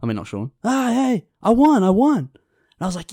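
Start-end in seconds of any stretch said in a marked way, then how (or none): 0.6-2.2 high-pitched excited announcement; retold cry of victory; excited winner. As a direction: crow about a win, voice pitched up. Bettor returns announcing his win.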